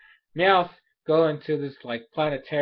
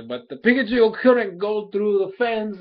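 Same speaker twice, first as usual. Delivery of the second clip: usual speaking voice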